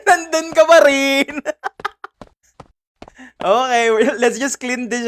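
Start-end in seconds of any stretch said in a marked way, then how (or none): 0.1-1.4 high, loud, drawn-out cry; startled shout. Real, not acted. Streamer finding the thing still there, loud drawn-out shout. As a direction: call it out slowly in disbelief.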